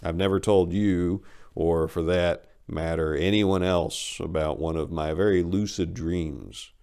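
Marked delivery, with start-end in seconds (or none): none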